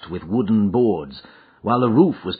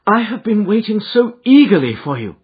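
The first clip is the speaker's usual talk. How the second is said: high, louder welcome from a lady; excited, raised-pitch greeting; eager cry; 12 semitones higher, 7 dB louder than usual. A narrator's reading. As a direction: greet him with eager relief. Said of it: "she cried".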